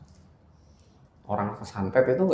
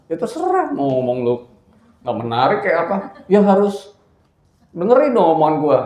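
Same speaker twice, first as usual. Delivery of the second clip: loud, high scolding father; angry father; 8 semitones higher, 11 dB louder than usual. Retold or imitated. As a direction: bark at the son who won't listen. Father scolds in retold argument. (bapak marah, suara keras dan tinggi)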